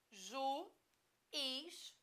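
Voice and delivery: ju... is, each syllable held apart. Shown as a demonstration. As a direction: split juiz into drawn-out ju, then is. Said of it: "quando você separa".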